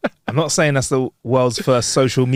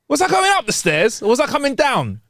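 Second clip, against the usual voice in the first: mock yell; 14 semitones higher than usual. A narrator's reading. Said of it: "he pauses and then yells".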